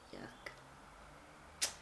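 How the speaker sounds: breathy disgusted whisper of yuck; a quick disgusted aside; grossed-out yuck. Real, not acted. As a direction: recoil at something gross. Reacting to glue from animal skin.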